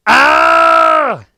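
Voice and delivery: a huge high scream of pain. Acted. Mike screams as the zombie rips him apart. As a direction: shriek as the zombie bites.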